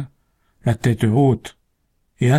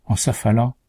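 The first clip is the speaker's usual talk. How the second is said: ordinary delivery, nothing marked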